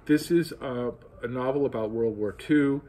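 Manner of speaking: usual speaking voice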